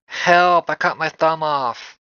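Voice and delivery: high, loud fake panic; mock cry for help. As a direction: yell for help, hammed up.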